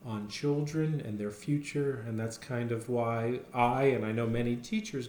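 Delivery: ordinary delivery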